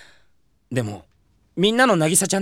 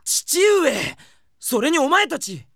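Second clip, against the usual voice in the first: higher, startled cry then slower; shocked cry; 8 semitones higher, much slower than usual. Acted. The hero cries out in shock at his father and friends spying. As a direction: yelp in shock at being caught.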